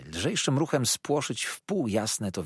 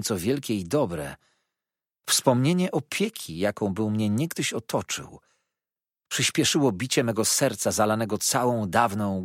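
ordinary delivery

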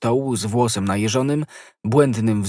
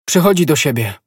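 louder, higher burst of relief; relieved exclamation; 6 semitones higher, 7 dB louder than usual. A narrator's reading. The young man sees her wake, relieved exclamation. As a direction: cry out with sudden hope. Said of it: "zawołał młodzieniec".

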